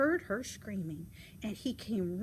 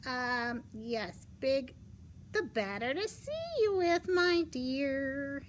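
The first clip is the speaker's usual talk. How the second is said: loud, rising fake-granny voice; drawn-out sly granny act; wolf posing as Grandma; 3 semitones higher, 4 dB louder, slower than usual. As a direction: coo as a wolf playing granny, climbing high. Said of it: "the wolf".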